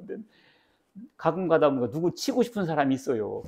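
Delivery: ordinary talk, nothing marked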